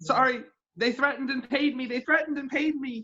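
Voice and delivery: high-pitched pleading character voice; pleading informant. Pretend. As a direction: confess in a high, pleading put-on voice.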